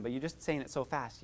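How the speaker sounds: ordinary delivery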